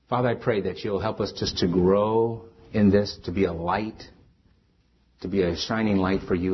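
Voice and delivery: low, earnest prayer voice; closing prayer. Acted. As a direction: pray it over the church, steady and humble.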